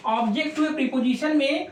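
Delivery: slow, careful dictation of the phrase; slow, spelled out. Shown as a demonstration. Teacher to his pupils, saying the rule slowly. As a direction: draw each word out for the students to note down. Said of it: "तो प्यारे बच्चों याद रखो".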